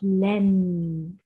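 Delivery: لن said slowly as "lan"; tanwin fatha sound. Shown as a demonstration. Teacher sounding out the tanwin syllable for children, slowly. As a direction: stretch out لن, ending on a clear n. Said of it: "وصوت تنوين الفتح لأن".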